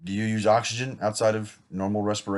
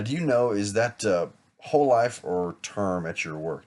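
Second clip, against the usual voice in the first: ordinary talk, nothing marked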